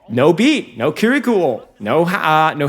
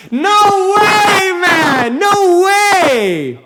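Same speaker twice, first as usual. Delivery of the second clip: much higher, loud disbelieving yell; ecstatic shout; 5 dB louder, much slower than usual. Real, not acted. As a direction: scream it like you can't believe it. Caster yelling at comeback.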